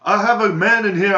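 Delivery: high, loud imitated call; hammed-up clerk calling police; mock clerk on phone. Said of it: "this guy's like".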